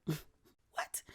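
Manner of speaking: breathless whispered what; mock disbelief in a hushed whisper; stunned whisper. Friend reacts to the Napa invite with a stunned whisper.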